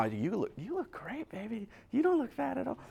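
lightly voiced sweet talk to a wife; mock reassurance. Husband softly reassuring his wife she looks great.

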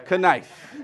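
knife said as kuh-NIFE; sounded K. Shown as a demonstration. Comic blaming the British spelling, knife as kuh-NIFE. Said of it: "put a K in knife".

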